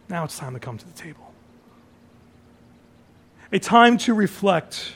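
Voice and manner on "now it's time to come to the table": much softer, fast, near-whispered echo; hushed repeat. Acted. Pastor to congregation, softly repeating the invitation. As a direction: let the line fall away to a hush.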